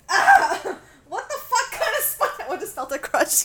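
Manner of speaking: high, loud panicked squeals; panicked shrieking. Real, not acted. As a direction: freak out over a spider. Panic over a spider.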